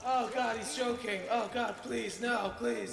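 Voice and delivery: high-pitched, pleading panic; mock panic. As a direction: beg like a shocked diner. Panics at the wine price.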